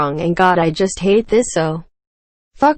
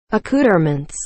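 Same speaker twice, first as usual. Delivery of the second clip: usual speaking voice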